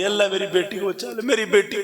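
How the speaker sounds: high, loud pleading mother; mother pleading to Allah. Acted. Preacher to listeners, the mother crying out, pleading. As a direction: beg Allah for your daughter's life. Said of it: "माँ कह रही".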